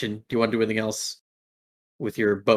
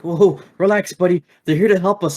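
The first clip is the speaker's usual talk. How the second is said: higher, louder, hurried reassurance; calming goon; 8 semitones higher, 7 dB louder than usual. Acted. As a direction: try to settle a panicking buddy. Goon calms the panicking thrall.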